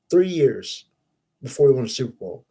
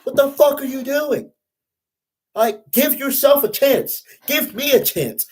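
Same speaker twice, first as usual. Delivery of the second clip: chewing out a receiver; 10 semitones higher than usual. Retold or imitated. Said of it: "Derek Carr got on his ass. He's like".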